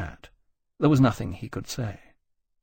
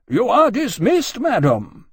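slow, loud, high-pitched order; icy peremptory dismissal; 11 semitones higher, 6 dB louder, slower than usual. Acted. Musgrave cutting Jane off as she goes on, peremptory. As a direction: dismiss her with deliberate force.

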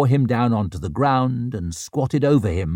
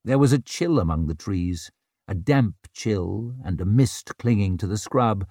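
plain reading voice

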